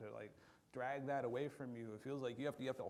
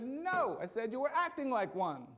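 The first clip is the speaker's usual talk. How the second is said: higher, louder pointed correction; correcting parent; 8 semitones higher, 6 dB louder than usual. Retold or imitated. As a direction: set the kid straight firmly.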